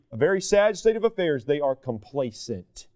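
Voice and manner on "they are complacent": slow, soft grave stress. Acted. Preacher drops voice for point.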